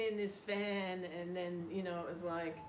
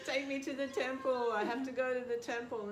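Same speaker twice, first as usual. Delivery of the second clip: eager plea; 7 semitones higher, 6 dB louder than usual. Retold or imitated. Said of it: "and I was like".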